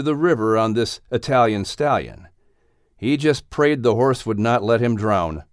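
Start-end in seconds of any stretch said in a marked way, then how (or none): none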